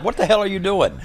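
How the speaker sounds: high-pitched mock indignation; retold pissed-off demand. Storyteller imitates Harley confronting Diamond over the drink, pissed off. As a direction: act out an annoyed friend's challenge. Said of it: "Harley's all pissed off".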